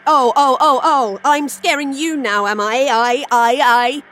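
loud, taunting, slow rise; mocking menace. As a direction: taunt her with crazed menace. Starla mocking threat.

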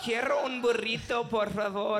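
hammed-up Spanish accent, rolled R's; mock Spanish accent. Mocking a friend's Thai, in a hammed-up Spanish accent. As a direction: overdo a Spanish accent for a laugh. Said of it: "You didn't need to do that voice".

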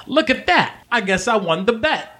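high-pitched brash gnome woman; female gnome, gloating. Acted. Gnome to her mark after winning a bet, gloating.

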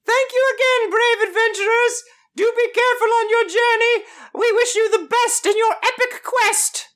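very high falsetto NPC voice; squeaky high old woman; high woman's voice. Acted. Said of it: "I tried really hard to be able to do a woman's voice".

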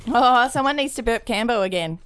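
ordinary delivery

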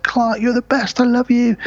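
high gushing wife voice; hammed-up adoring mum. Host voicing wife praising Clark, high gushing. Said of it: "Mum's like".

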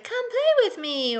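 very high, slow sing-song doll voice; high talking-toy voice; childish toy voice. Retold or imitated. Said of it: "childish kinda game voices".

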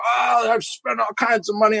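high, put-on whine of an entitled customer; entitled drunk protests he spent a lot, whining; whiny protest.

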